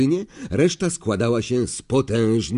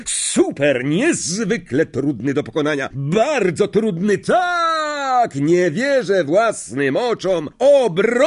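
much higher thrilled cheer; excited commentary; 8 semitones higher, slower than usual. Acted. Lee to the crowd, thrilled cheer. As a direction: cheer the save.

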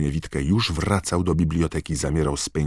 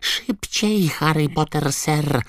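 very high, squeaky elf urging; squeaking house-elf; 14 semitones higher, 4 dB louder, slower than usual. A narrator's reading. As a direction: squeal at him to hurry. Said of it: "piszczał Zgredek". (piskliwy, ponaglający głos skrzata)

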